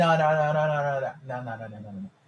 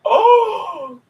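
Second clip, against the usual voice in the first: loud, much higher sudden cry; startled oh; 8 dB louder than usual. Real, not acted. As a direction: react as if something jumped out. Discovers the example on screen.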